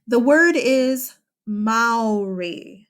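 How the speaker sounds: Maori said slowly as "MOW-ree"; slow careful pronunciation. Shown as a demonstration. Teacher introducing the word to students, slow careful "Maori". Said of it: "I hear people say Maori all the time".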